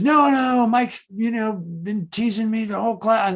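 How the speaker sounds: put-on high, loud whiny protest of a boy in trouble; imitating a kid who insists it is not his fault.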